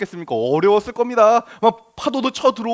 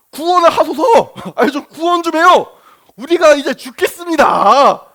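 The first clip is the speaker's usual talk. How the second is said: higher, louder, huffy plea; sulky demand; 6 semitones higher, 6 dB louder than usual. Retold or imitated. Scene: disciples wake sleeping Jesus, sulky and pushy. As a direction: snap out a sulking demand. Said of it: "제자들이 조금 마음이 상한 게 보이죠?".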